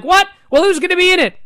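mock shocked women asking who's in it; the women reacting. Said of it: "They're like".

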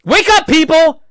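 loud high wake up call; host mocking the crowd, exasperated shouting; exasperated yell. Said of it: "and I was like".

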